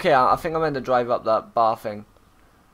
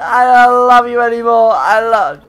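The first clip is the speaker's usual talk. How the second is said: mock hurt; 7 semitones higher, 9 dB louder than usual. Mock breakup with lookalike.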